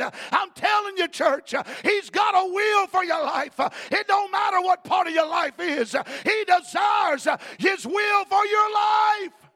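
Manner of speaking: loud, high preaching; full-force preaching. Acted. Preacher thundering at the church, loud and high. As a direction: thunder it to the congregation.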